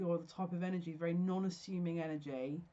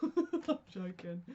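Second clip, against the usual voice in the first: laughing lightly through the words; much slower than usual. Real, not acted. Reader joking with viewers about taking a cut, laughing. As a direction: let a chuckle spill into it.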